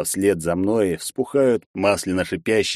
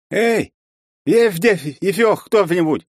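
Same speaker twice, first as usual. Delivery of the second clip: high, loud desperate calls; calling out; 11 semitones higher, 5 dB louder, slower than usual. Acted. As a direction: shout for anyone to answer. Герой зовёт в пустом зале. (громкий зов на помощь)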